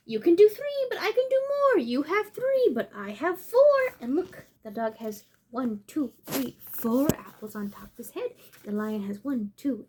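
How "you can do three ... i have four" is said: higher, louder show-off dog voice; bragging dog. Acted. The dog boasting to the lion in a higher, louder show-off voice. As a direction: crow over the lion like a boastful pup. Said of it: "and the dog says".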